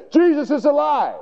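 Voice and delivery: high, exclaimed good news; acted excited announcement. Witnesses bursting in on the disciples, acted high excitement. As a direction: proclaim it high and thrilled.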